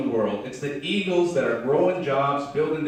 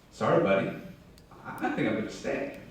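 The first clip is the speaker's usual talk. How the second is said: sheepish chicken; 4 semitones lower, 6 dB quieter, faster than usual. Acted. Chicken declines to leave.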